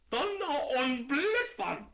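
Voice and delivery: high, loud, slow German-flavoured curse; yelled. Acted. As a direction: yell it in dismay. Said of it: "yelled the delicatessen man".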